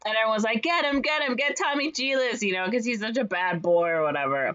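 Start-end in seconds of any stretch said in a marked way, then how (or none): 0.6-2.4 mock crowd outcry. Crowd calls to catch culprit.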